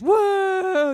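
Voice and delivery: high, drawn-out mock crowd whoa. Audience reacting to a stage question, mock whoa. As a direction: gasp like an impressed audience. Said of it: "And everybody's".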